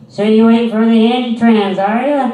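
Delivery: put-on high, loud condescending old lady; mocking a patronizing old lady at the mall. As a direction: talk down to him like a child, sugary and loud. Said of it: "old lady voice continues".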